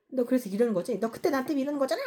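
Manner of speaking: mock an indignant, pushy complaint; accusing friend. Acts out a friend accusing someone.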